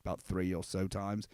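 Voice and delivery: put-on low, soft, weary voice of the old sheep. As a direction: sink low and quiet like an old sheep remembering. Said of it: "And he goes, like".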